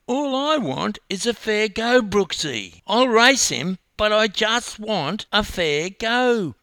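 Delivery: high, loud, wailing plea; pleading complaint. A narrator's reading. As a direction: plead for fairness, high and aggrieved. Upset swimmer pleads for fair race.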